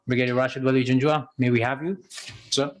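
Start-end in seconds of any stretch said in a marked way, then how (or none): none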